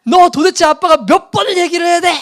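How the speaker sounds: put-on loud furious yell at his child; shouting scolding. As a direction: explode at the kid for disobeying. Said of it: "소리를 지르면서 아이를 막 혼냈어요".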